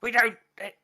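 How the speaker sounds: high, urgent trapped pirate voice; panicked pirate. Acted. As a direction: plead for help from inside the gem.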